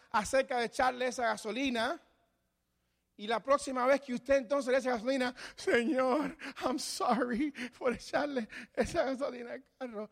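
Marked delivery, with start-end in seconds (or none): 5.5-10.1 higher, whiny pretend sorrow; mock tearful prayer. A sinner cries to the Lord, hammed-up sobbing. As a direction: ham up a teary apology to God. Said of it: "y está llorando".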